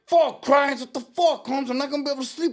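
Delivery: high-pitched panicked yelling; loud, hammed-up distressed protest; frantic outrage. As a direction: imitate a friend freaking out in the dark. Acts out friend panicking in dark.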